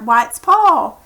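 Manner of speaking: ordinary delivery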